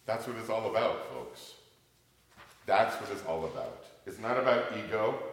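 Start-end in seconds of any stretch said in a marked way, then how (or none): none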